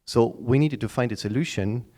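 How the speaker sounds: ordinary talk, nothing marked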